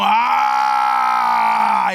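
low, loud mock roar; shouted mock lion roar. Viene el león.

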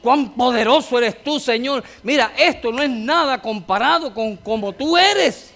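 loud, high, fervent preacher's cry; preaching at full force. Acted. Pastor rousing his congregation about God's power, loud and fervent. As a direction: thunder it out to the church like a revival preacher.

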